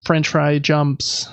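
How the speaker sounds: ordinary talk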